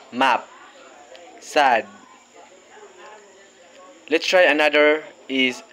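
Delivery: "apple" with a short, open A; short A words. Shown as a demonstration. Teacher modelling short A words for kids. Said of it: "Let's try a short A sound".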